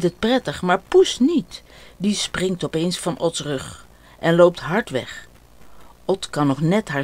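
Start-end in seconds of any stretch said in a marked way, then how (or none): none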